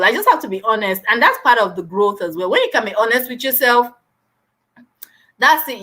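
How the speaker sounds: ordinary talk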